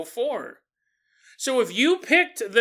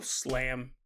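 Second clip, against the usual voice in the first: ordinary talk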